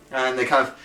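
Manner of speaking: ordinary delivery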